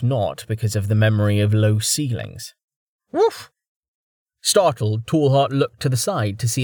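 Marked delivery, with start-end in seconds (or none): 3.1-3.5 short, high-pitched woof; dog bark. A narrator's reading. Dog to the man on deck, high woof. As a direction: give one quick high dog yip. Dog demands Tallheart's attention.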